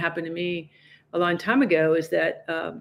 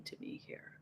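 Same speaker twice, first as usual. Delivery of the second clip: whisper; 26 dB quieter than usual. Retold or imitated. Asking herself what is happening.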